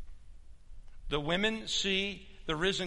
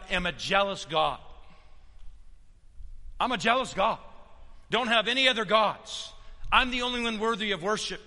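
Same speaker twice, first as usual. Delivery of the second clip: loud, raised pulpit proclamation; forceful preaching; 5 semitones higher, 5 dB louder than usual. Acted. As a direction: declare God's command to the whole room.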